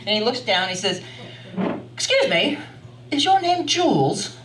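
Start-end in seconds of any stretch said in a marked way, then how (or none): 1.9-4.4 high, Downton Abbey English voice; English accent. Retold or imitated. Stranger asking a woman on a bench her name, English accent. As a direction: ask politely in upper-class English tones, pitched up. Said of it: "Downton Abbey English voice".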